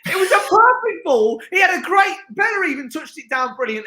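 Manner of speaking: high-pitched, outraged complaint; exasperated rant. Real, not acted. Host fumes at Bella wasting Harper's ball, exasperated rant. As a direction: protest the wasted chance in disbelief. Host rages at a missed chance.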